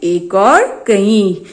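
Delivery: "एक और कई" spelled out slowly, each word apart; slow drilled answer. Shown as a demonstration. Teacher drilling the class on one and many, slow and deliberate. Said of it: "याद रखिए".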